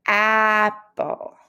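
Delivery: "apple" with a clear short a /æ/ at the start. Shown as a demonstration. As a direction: stretch the opening ah of "apple" for the class. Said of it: "what we hear in the beginning of the word".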